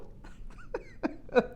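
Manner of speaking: very high, breathless laugh; high giggle. Real, not acted. Reader amused by the viewer's energy, high giggle. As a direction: break into squeaky giggles. Laughs after her own whoop.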